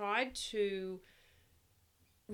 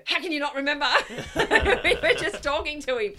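laughing, louder and higher exasperated question; laughing disbelief; 8 semitones higher, 11 dB louder, faster than usual. Retold or imitated. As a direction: laugh through the mock exasperation. Mother's exasperated retold question.

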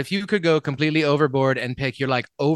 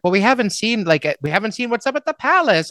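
imitating parents; 8 semitones higher, 4 dB louder than usual. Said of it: "and they're like".

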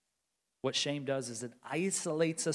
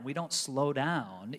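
ordinary talk

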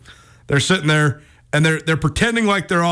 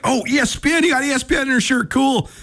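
imitating an impressed kid, pitched up high; higher, quick, mock-starstruck kid voice; excited kid; 8 semitones higher than usual. Retold kid spots the shirt.

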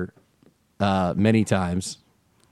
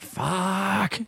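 muttered Jersey swear; 9 semitones higher, 4 dB quieter than usual. Retold or imitated. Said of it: "I was like".

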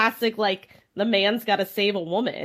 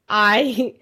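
smoker's rasp; 4 dB louder than usual. Real, not acted. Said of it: "I sound like I have a smoker's cough".

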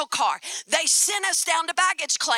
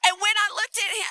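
ordinary delivery

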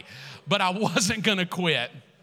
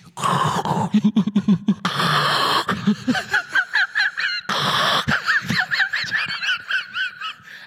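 loud, high hammed-up sobs through a mask; mock sobbing; 6 dB louder than usual. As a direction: wail and heave in exaggerated crying. Demonstrates crying in his mask.

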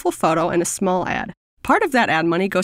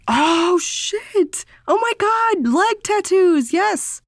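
much higher, thrilled squeal; excited; 10 semitones higher, much slower than usual. Real, not acted. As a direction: squeal with delight. Excited by tattoo idea.

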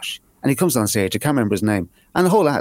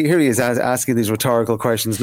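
usual speaking voice

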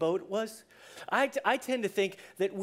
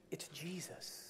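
soft, low whisper; hushed reveal; 5 semitones lower, 18 dB quieter, slower than usual. Shown as a demonstration.

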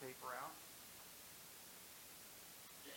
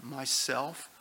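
louder, clear and joyful; the voice names itself again, louder, clear and glad; 2 semitones higher, 9 dB louder than usual. Acted. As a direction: ring it out gladly.

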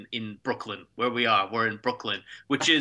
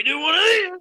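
rising, louder strain on the last word; effort of a throw; 12 semitones higher, 7 dB louder than usual. Pretend. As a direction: strain upward as you heave someone over. Demonstrating a wrestling throw.

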